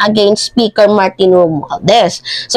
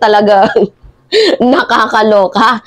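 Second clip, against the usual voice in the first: usual speaking voice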